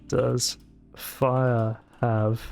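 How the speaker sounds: slow, carefully spaced search question; voice search query. Shown as a demonstration. Asking Google how many syllables fire has, slow and spaced. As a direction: speak it slowly to a search assistant. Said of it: "let me Google it".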